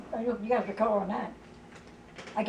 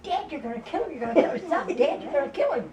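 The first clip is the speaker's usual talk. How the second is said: mock frantic pleading; 7 semitones higher than usual. Children pleading during retold beating.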